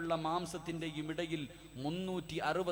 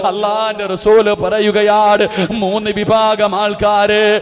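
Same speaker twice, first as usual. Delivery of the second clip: loud, chanting sermon cry with long-drawn endings; impassioned preaching; 4 semitones higher, 24 dB louder than usual. Acted. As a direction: preach at full force, stretching the last word of each phrase. Preaches at full force. (ഉച്ചത്തിൽ ഈണത്തിലുള്ള വികാരഭരിത പ്രസംഗം)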